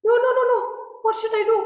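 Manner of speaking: very high, loud panicked wail; frantic despair at the bleeding eye; panicked cry. Acted. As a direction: devotee seeing Shiva's eye bleed, panicking loudly. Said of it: "He says".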